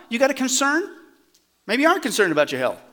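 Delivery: ordinary talk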